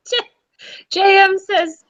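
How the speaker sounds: ordinary talk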